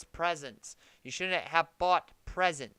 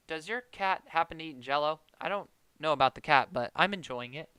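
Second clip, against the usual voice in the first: usual speaking voice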